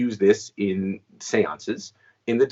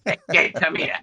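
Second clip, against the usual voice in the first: higher, laughing gruff-old-man imitation; a chuckling, raised mock of the old man; mimicking an old man; 8 semitones higher than usual. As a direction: send up the old guy calling him kid. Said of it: "an older man".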